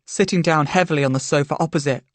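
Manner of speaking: usual speaking voice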